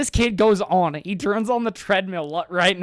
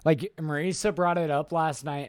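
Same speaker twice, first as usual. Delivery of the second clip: ordinary talk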